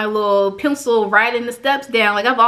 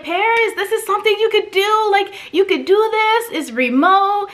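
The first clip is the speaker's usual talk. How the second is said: mom's voice; 9 semitones higher than usual. Retold or imitated. Mom pitching tech career.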